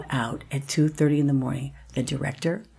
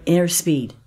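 ordinary delivery

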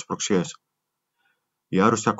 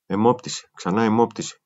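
ordinary delivery, nothing marked

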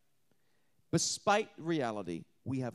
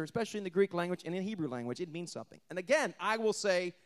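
usual speaking voice

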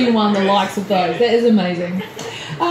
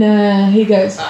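ordinary talk

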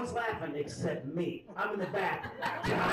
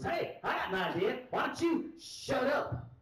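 pitched-up, drawn-out angry retort; exasperated, slowing to a high "shut up"; mock snapping; 8 semitones higher than usual. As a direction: imitate a fed-up guy snapping at a heckler. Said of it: "Finally, he just snaps".

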